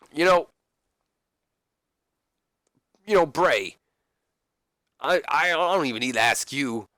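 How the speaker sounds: lively moderator. Acted. Voices Piper as moderator.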